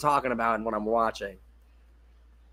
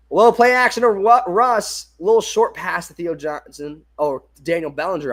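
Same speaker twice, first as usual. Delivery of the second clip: quick excited broadcast call, higher and louder at the start; play-by-play call; 4 semitones higher, 6 dB louder than usual. Acted. Breaking in mid-chat to call the pass, excited play-by-play.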